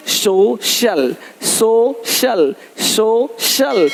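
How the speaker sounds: सोशल drawn out in two parts, "so... shal", with sh; slow syllable demo. Shown as a demonstration. English teacher showing that C before IA sounds sh, slow syllable demo. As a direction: break सोशल slowly into "so" and "shal", falling at the end. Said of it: "सी का उच्चारण श होता है".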